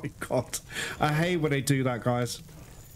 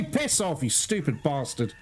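usual speaking voice

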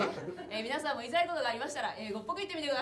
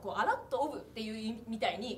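ordinary delivery

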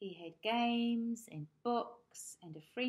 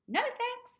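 very high, loud chirp; chirping birds; 10 dB louder than usual. Acted. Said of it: "they chirped".

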